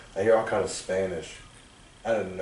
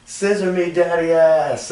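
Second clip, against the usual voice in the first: high, loud imitation of a wrestler; wrestler's catchphrase yell; 8 semitones higher, 6 dB louder than usual. Wrestler calling out his catchphrase on entrance, imitated yell. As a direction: belt out the catchphrase to the crowd. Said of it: "he'd be like".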